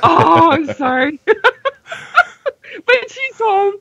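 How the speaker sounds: high, laughing and tearful; laugh-crying. Real, not acted. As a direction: laugh through tears of relief. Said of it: "I'm crying now".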